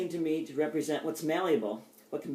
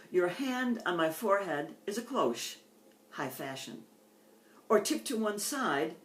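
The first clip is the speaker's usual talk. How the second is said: tender, unhurried love poem reading; 4 semitones higher than usual. Acted. Poet reading her love poem Hat to the audience, tender and unhurried.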